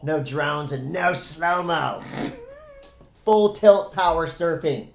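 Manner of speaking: loud mock Aussie accent; Australian accent. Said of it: "in an Australian accent".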